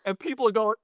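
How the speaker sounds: ordinary talk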